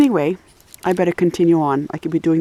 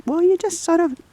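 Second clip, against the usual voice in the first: mock reply to the dog; 8 semitones higher than usual. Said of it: "I'm like".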